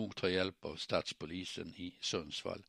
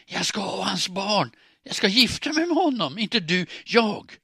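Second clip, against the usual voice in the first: much higher, furious hoarse shout; hoarse, raised voice; 12 semitones higher, 12 dB louder than usual. A narrator's reading. Woman claims Anders from Klara, hoarse raised shout. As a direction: scream your claim at a rival, far louder and higher. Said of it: "Rösten blev ännu hesare, men högre".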